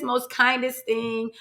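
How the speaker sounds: ordinary talk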